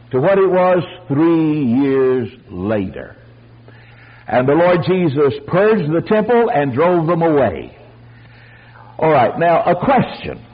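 normal talking voice

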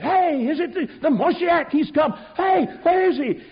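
high, loud, eager calls; excited shouting. Retold or imitated. Men running down the hill seeking the Messiah, high excited shouts. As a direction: call out hunting for the Messiah.

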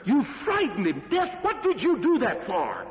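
a sheik scolding Death for frightening his servant; angry sheik. Acted. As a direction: confront Death over the servant. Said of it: "And the sheik was angry".

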